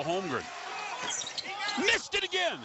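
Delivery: normal talking voice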